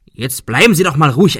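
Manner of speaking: loud, cross scolding. Acted. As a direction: snap at him to calm down. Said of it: "schimpfte Arthur".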